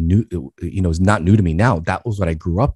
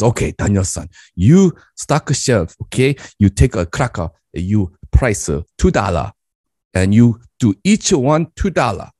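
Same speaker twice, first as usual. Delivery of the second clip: put-on lightly accented broken English of an immigrant dad; Korean dad accent; 2 semitones higher than usual. Dad instructs son to price crackers, lightly accented. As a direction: play the dad giving his son blunt orders.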